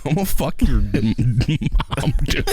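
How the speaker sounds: slow, laughing through it. Real, not acted. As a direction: giggle through the line. Laughing joke.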